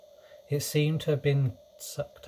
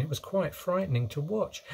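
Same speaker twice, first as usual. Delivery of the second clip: plain reading voice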